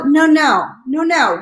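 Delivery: ordinary delivery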